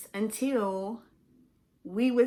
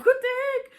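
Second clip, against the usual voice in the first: put-on scared kid; 7 dB louder, much faster than usual. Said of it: "I'm like".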